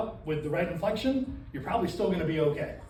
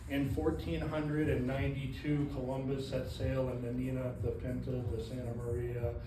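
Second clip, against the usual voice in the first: boring teacher; 6 semitones lower, 7 dB quieter than usual. Acted. Trainer acting out a boring teacher reading to a class, droning. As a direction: drone the history lesson out lifelessly. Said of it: "that teacher was boring".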